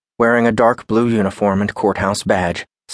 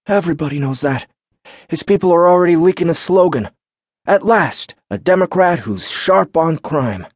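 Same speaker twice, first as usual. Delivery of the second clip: mock campaign slogan; 9 semitones higher, 3 dB louder than usual. A narrator's reading. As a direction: sell it like a campaign ad. Baron mocking slogan.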